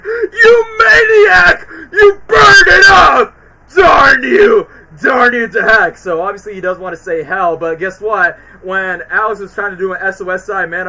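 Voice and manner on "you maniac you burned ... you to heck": much higher, loud, slow enraged screaming; furious mock yell. Alex screams at Melman, hammed-up fury. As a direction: scream at the arsonist. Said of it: "Alex was like".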